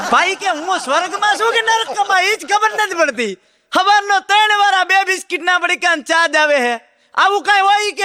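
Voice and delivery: high, laughing, fed-up man in heaven; exasperated complaint. Acted. Man in heaven complaining to the attendant, high and exasperated. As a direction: whine like a man cheated out of paradise.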